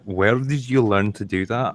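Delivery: lightly voiced Scottish questioner; imitated Scottish accent. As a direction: ask it with a puzzled Scottish lilt. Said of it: "gave Dankula a Scottish accent".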